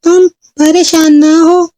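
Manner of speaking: high-pitched fairy voice; reassuring fairy. Acted. Fairy comforts girl with shared trouble, high reassuring voice. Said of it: "परी ने चुलबुल से कहा".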